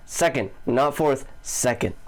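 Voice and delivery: "Second" and "fourth" both hit hard, contrasting; stress on second. Shown as a demonstration. Correcting the count for the viewers: "second" stressed. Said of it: "second thing I wanna cover".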